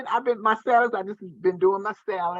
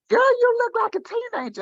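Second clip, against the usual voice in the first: high voice, gushing praise; sister's gushing voice. Retold or imitated. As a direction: gush like an impressed sister. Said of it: "She was like".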